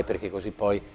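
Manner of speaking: ordinary delivery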